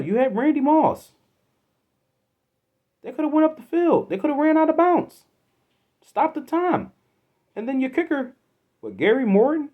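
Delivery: high, loud furious ranting; angry rant. Real, not acted. Fan shouting at the coach over wasted timeouts, furious. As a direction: tear into the coach, voice way up.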